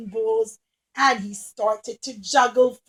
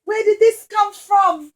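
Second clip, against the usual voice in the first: higher, louder surprised cry; amazed woman; 6 semitones higher, 8 dB louder, slower than usual. Acted. Woman finds crown at her feet, amazed. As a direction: exclaim in wonder. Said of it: "she looked down and she said".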